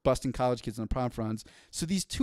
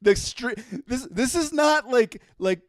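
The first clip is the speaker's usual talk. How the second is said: high pitched, half laughing outrage; laughing disbelief; 7 semitones higher, 5 dB louder than usual. Real, not acted. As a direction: laugh through the words in disbelief.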